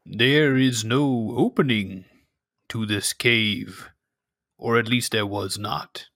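slow, odd villager greeting; cave dweller voice. Acted. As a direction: greet the stranger warily. GM voices Tunach.

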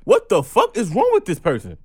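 outraged on a friend's behalf; indignant outburst. Retold or imitated. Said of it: "I'm like".

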